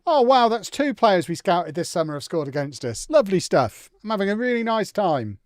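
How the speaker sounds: high, loud mock delight; exasperated sarcasm. Real, not acted. Player reacting to scouted players scoring against them, bitterly sarcastic. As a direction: gush sarcastically through real exasperation. Sarcasm at conceding goals in game.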